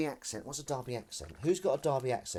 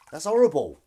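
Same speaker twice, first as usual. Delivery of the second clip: loud, higher-pitched horrified exclamation; appalled outburst; 4 semitones higher, 13 dB louder than usual. Real, not acted. Reacting to an actor's lost job, loudly appalled. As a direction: burst out, appalled. Appalled at casting mistake story.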